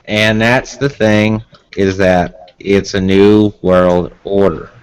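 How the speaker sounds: slow, deliberate presidential impression; a Connecticut man trying to sound Texan, slowly; George H. W. Bush. Shown as a demonstration. Showing friends the trick of a president's voice, slow H. W. impression. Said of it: "the trick of the H W".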